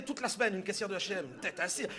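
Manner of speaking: ordinary talk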